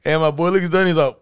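ordinary talk, nothing marked

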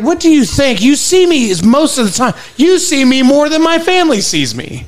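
high-pitched exasperated rant. Acted. Worker telling off screeners who see them daily, exasperated. Acted complaint to health screeners.

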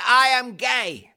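slow, high mock confession; imitating the fisherman. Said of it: "He said".

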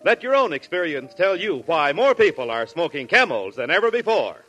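ordinary talk

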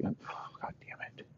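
whispered, breathy under-the-breath curse. Acted. Elway curses under his breath in a whisper. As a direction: hiss it to yourself.

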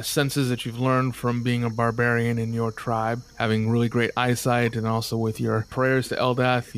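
ordinary delivery

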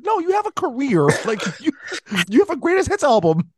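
high-pitched, exasperated objection; incredulous protest. Acted. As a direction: protest in disbelief, voice climbing high. Mock argument with singer's backstory.

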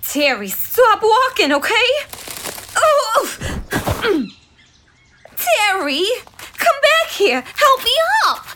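high urgent calls after someone; calling out. Acted. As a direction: call him back, high and anxious. Lynn calls after wandering Terry.

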